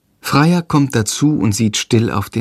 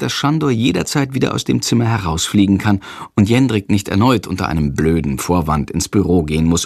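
usual speaking voice

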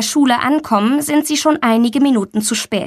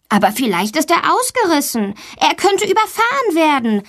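put-on higher-pitched, worried girl; 7 semitones higher than usual. A narrator's reading. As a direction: voice rising with concern. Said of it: "sagt Valentina besorgt".

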